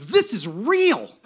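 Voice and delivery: loud, slow, emphatic thrill; amazed, stretching each word; so excited. Retold or imitated. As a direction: proclaim it with big excitement. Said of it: "I was so excited".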